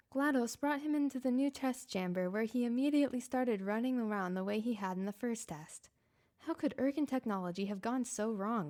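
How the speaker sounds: ordinary delivery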